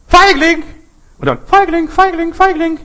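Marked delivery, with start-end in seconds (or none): 0.1-0.6 high, loud shout into the forest; shouted call. Acted. Child obeys father and calls out, loud high shout. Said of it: "Ruft jetzt mal Feigling". 1.4-2.8 high, loud repeated calls; echo from the forest. Acted. Forest returns the child's insult, loud high echo. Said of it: "Halt es aus dem Wald raus".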